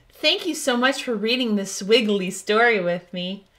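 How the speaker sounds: usual speaking voice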